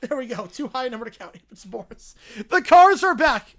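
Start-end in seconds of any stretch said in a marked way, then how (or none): 2.5-3.5 excited. Real, not acted. Excited announcement of cars.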